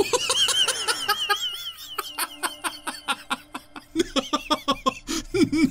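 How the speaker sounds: high, helpless laughing fit; long high laugh. Real, not acted. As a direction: crack up and keep laughing. Binkus hit in cutscene.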